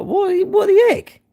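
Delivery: very high, slow mock astonishment; baffled squeak. Reacting to five silent viewers, squeaky astonishment. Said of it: "I'm like".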